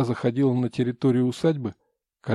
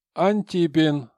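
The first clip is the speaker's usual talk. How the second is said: loud, higher name call-out; town crier; 5 semitones higher, 5 dB louder, slower than usual. Acted. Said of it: "И уже голосом глашатая".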